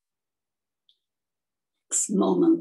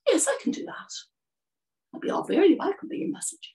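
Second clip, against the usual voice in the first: high-pitched willing little bird; eager swallow; 7 semitones higher, much faster than usual. Acted. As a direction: agree readily in a raised, birdlike voice. Swallow agrees to be messenger.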